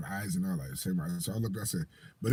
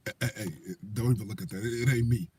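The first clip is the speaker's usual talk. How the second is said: low, much softer, sheepish confession; hushed admission; 5 semitones lower, 6 dB quieter, faster than usual. Retold or imitated. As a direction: quietly own up before getting caught. Kid admits ID isn't his.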